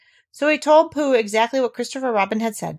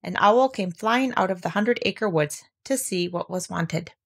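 usual speaking voice

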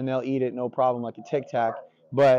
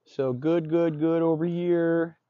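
slow, higher sing-song praise; drawn-out praise; 4 semitones higher, slower than usual. Real, not acted. Trainer showing the dog where good is, drawn-out praise. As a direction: stretch out each "good" for the dog.

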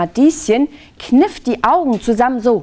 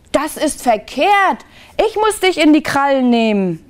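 higher-pitched, mild storybook outcry; vulture's protesting cry; 6 semitones higher than usual. A narrator's reading. Lämmergeier ridden by Lieschen, mild protesting cry. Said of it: "schrie der Lämmergeier".

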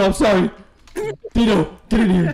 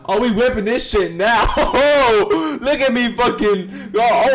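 giddy laughter through the words; excited laughing brag; laughing excitedly; 6 semitones higher than usual. Real, not acted. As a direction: laugh while bragging about the ride. Driving the go-kart, laughing.

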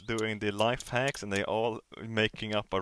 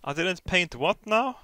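higher, louder and slow disbelief; incredulous; 8 semitones higher, 7 dB louder than usual. Real, not acted. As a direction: stretch it out in disbelief. Incredulous at game prompt.

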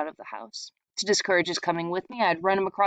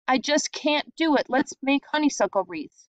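discouraged; 7 semitones higher than usual. A narrator's reading. Doodle giving up.

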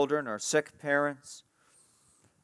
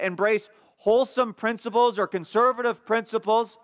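high-pitched, emphatic pulpit exhortation; forceful preaching; 8 semitones higher, 4 dB louder than usual. Real, not acted. Preacher urges Christians toward doing good, forceful preaching. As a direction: drive it home to the congregation, voice raised.

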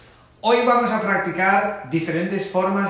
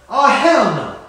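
loud refusal; 5 semitones higher, 7 dB louder, slower than usual. Acted. Refusing to lend money.

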